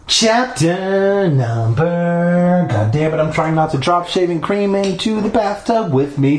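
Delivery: plain reading voice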